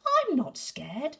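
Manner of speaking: high, loud, slow boast; high brave boast; brave child. Acted. Gruffalo's child insists again she's not scared, slow and high.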